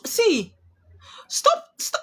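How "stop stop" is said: sharp stop. Real, not acted. Cuts off a viewer, Moonchild.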